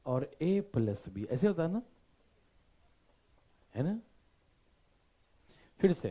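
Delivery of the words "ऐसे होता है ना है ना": ordinary talk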